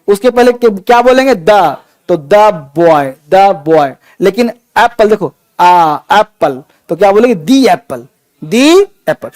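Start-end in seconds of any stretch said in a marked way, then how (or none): 1.3-3.9 द said short as "duh", slowly; द boy, the drawn out slow and low; slow demo of "the". Shown as a demonstration. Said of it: "consonant पहले हुआ तो द बोलेंगे". 7.4-8.1 दी said long as "thee" before एप्पल; demo "thee apple". Shown as a demonstration. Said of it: "vocal के पहले हुआ तो दी बोलेंगे".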